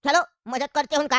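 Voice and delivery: put-on low, easygoing old uncle. One speaker voices the old uncle.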